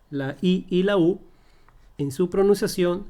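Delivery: normal talking voice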